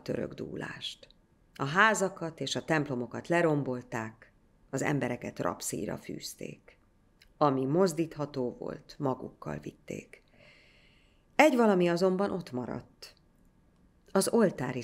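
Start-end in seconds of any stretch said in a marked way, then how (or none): none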